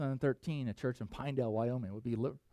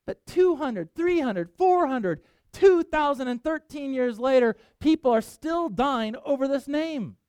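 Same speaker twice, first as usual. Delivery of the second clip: emphatic preaching; 10 semitones higher, 6 dB louder, slower than usual. Real, not acted.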